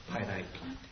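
very soft, low repeat; hushed echo. Real, not acted. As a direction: drop right down to a reverent hush. Hushed echo after loud proclamation.

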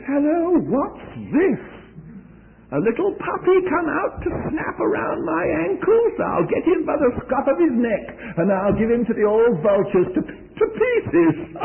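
a hint of a giant laughing at a boy; mocking giant. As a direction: taunt the boy like a scornful giant. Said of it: "the great big fellow's grinning at him, laughing at him, and says".

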